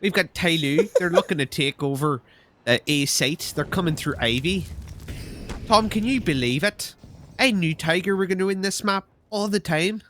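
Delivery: put-on casual caster putting on Dinko's accent; lightly voiced Dinko, teasing; Dinko impression. Co-caster teasing by voicing Dinko, light accent. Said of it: "the current accent you're doing for him".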